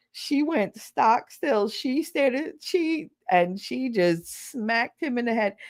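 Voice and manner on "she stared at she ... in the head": ordinary talk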